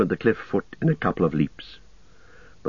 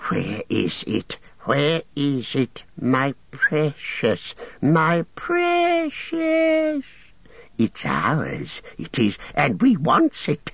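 slow, high wail for the lost precious; whining Gollum creature voice; 6 semitones higher, much slower than usual. A narrator's reading. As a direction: moan like a creature robbed of its treasure. Gollum searching, hissing.

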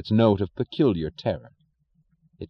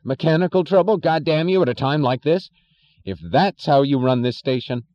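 angry shouting; 7 semitones higher, 4 dB louder than usual. Acted. Furious boss berating engineer.

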